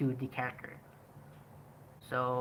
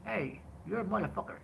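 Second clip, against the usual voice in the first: mock insult; 7 semitones higher, faster than usual. Someone calls me, acted insult.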